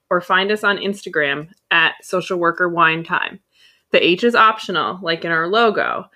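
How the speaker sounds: loud, projected scripted read; podcast outro read. Acted. Host asking listeners for funny work stories, announcer read. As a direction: give the contact details like an announcer.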